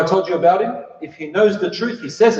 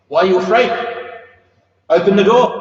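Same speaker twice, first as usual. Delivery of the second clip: louder, higher, confident; bold call; 5 semitones higher, 4 dB louder than usual. Retold or imitated. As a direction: urge them boldly, no fear. Hamza urges opening the door.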